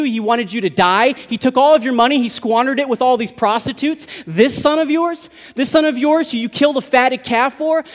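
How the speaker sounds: high, loud, bitter protest; resentful older brother. Acted. Older brother bitterly accusing the father. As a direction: rail at the father over the prodigal son. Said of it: "The older brother who said".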